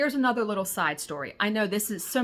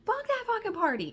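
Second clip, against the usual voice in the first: much higher, squeaky swearing; high-pitched outburst; 13 semitones higher than usual. Retold or imitated. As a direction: squeal it, fed up. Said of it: "That's exactly what I said".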